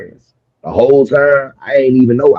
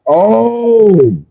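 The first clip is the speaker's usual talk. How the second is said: surprised oh; 11 semitones higher than usual. Real, not acted. Sudden surprised oh at question.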